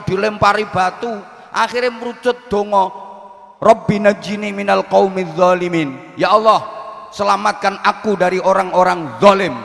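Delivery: high, pressing sermon voice; fiery preaching. Acted. Preacher recounts Lot's people destroyed, heated. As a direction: tell the story with rising fire.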